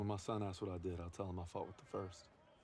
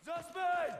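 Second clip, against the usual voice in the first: battlefield shout; 6 dB louder than usual. Acted. Soldier yelling for a comrade under fire, loud shout. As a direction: yell the name high and loud across the field.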